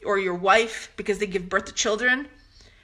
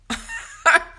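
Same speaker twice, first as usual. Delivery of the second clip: short, very high laugh; scoffing laugh; 4 dB louder than usual. Real, not acted. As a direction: burst of high, derisive laughter. Laughs at being thought desperate.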